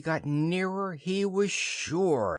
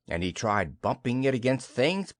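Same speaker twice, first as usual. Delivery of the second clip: ordinary delivery, nothing marked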